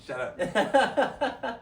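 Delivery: high, loud "Shut up"; mock shout. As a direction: bark back in playful outrage. Mock retort among friends.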